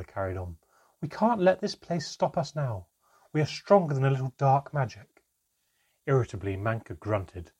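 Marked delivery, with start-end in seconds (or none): 1.0-5.2 higher and louder, firm; determined rally. A narrator's reading. Mother rallying the group against dark magic, loud and firm. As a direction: push them on with conviction.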